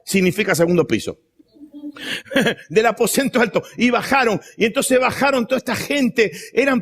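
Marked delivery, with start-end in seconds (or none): 1.8-2.6 short soft laugh. Real, not acted. Preacher laughs at own joke.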